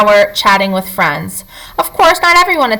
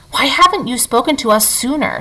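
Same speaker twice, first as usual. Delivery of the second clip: usual speaking voice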